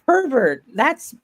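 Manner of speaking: high-pitched, laughing retort; playful high-voiced huff; mock indignation. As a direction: snap back in jokey outrage. Playing someone defending smuggled candy.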